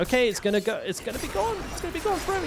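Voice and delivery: squeaky, very high mock insistence; high-pitched warning. Repairman warning a customer his files will go, squeaky mock insistence.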